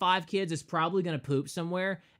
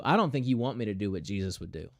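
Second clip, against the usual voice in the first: usual speaking voice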